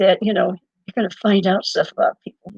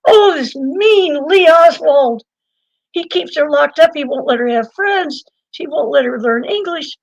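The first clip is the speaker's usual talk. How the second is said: mock lament; 6 semitones higher, 4 dB louder than usual. Imitating complaints about cruel husband Lee, mock lament. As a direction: bewail it in a hammed-up, higher voice. Mimics talk about mean Oswald.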